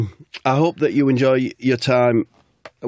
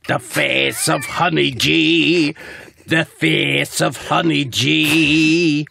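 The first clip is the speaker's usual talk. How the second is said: very slow and drawn out, higher, building; dramatic echo; 5 semitones higher, much slower than usual. Acted. Narrator repeating the beast's horrifying face, slow rising melodrama. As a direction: wail it out like a horror refrain.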